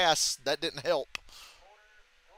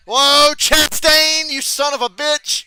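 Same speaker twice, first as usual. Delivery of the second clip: drawn-out, much louder, higher cry of shock; yelling at a rival's move in disbelief; 10 semitones higher, 12 dB louder, much slower than usual. Real, not acted. Gamer yells at Chastain's surprise move, shocked and loud.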